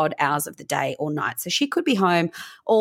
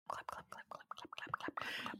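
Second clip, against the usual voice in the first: whispered clap clap clap, softly; whispered clapping; 24 dB quieter than usual. Acted. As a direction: fluister de klaps zachtjes, ritmisch. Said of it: "Pop your claps in there".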